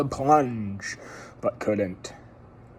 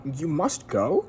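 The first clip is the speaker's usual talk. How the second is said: polite; 6 semitones higher, slower than usual. A narrator's reading. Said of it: "the lady said politely".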